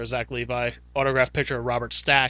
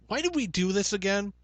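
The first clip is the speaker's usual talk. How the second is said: higher, louder mock accusation; mock blame; 7 semitones higher, 8 dB louder than usual. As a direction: pin the blame on him, hammed up. Acted quarrel between hosts.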